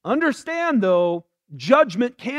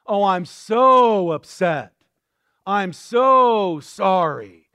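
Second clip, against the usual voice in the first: slow, louder, hammed-up sorrow; mock lament; 5 dB louder, slower than usual. Mocking a showy repentance before God, hammed-up and slow. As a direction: overdo the apology like a show. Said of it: "there's this great outward show".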